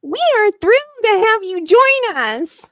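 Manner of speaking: over-the-top thrilled greeting; enthusiastic principal. A narrator's reading. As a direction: burst out with hammed-up delight. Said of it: "her voice rich with genuine enthusiasm".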